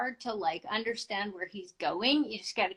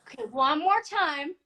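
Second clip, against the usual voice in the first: disbelieving request to repeat; 8 semitones higher, 3 dB louder than usual. Retold or imitated. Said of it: "And I'm like".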